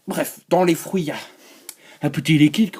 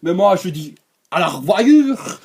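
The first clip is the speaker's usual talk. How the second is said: hammed-up presenter; 6 semitones higher, 5 dB louder than usual. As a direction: overblown opening announcement with stretched, high words. Exaggerated comic presenter accent.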